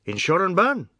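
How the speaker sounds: "Insure and burn" run together as a single word; run into one word. A narrator's reading. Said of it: "making it one word".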